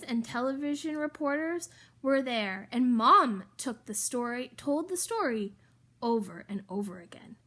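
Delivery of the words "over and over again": quick unvoiced whisper; quick breathy aside whisper. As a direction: hush it fast as an aside.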